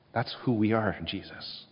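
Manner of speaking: ordinary talk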